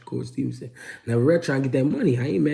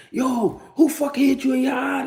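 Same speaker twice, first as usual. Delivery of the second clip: higher, sharp, lightly voiced big sister; upset older sister; 13 semitones higher than usual. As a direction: play the outraged big sister demanding who did it. God sister upset, retold scene.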